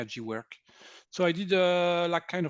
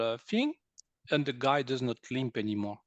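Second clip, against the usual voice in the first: ordinary talk, nothing marked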